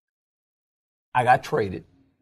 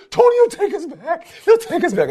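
high imitation of a frantic wife; mock hysterical; 4 dB louder than usual. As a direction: plead in a hammed-up panic. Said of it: "she's hysterical, man".

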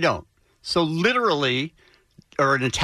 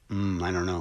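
suspicious; 11 semitones lower, 6 dB quieter than usual. Retold or imitated. Doubting a waiter's accent.

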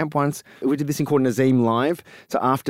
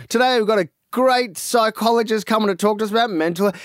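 host announcement; 8 semitones higher than usual. Retold or imitated. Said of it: "the guests would be".

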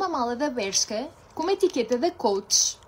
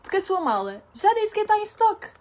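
imitating the clerk; 8 semitones higher than usual. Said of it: "E pior".

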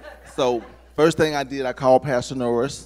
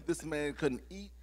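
usual speaking voice

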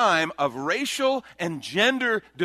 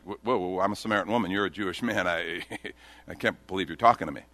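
low, stammered mild disbelief; mock shock; 6 semitones lower than usual. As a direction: stammer in understated shock with a small laugh. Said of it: "she's shocked. She doesn't know what to do".

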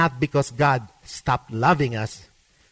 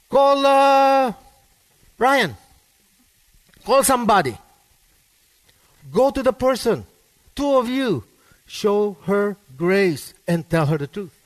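a touch of a loud, higher commanding voice; voice of the Bible; 4 semitones higher, 4 dB louder than usual. Pretend. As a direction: proclaim the command like scripture speaking. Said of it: "The Bible says".

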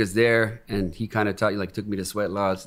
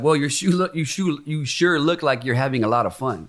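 a mock square onlooker voice, a little higher; 4 semitones higher than usual. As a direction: imitate strangers teasing you. Mocking onlookers' comment.

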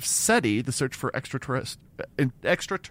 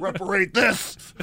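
defiant retort; 7 semitones higher, 3 dB louder, slower than usual. Retold or imitated.

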